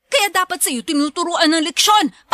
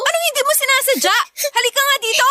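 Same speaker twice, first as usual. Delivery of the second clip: angry scolding; 6 semitones higher than usual. Acted. Sister grabs girl angrily.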